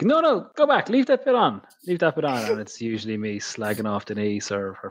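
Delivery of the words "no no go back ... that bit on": high mock voice of the wife begging; imitating his wife. Husband mimicking his wife asking him to leave a clip in. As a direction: squeak out his wife's plea to keep the clip in. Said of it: "she's like".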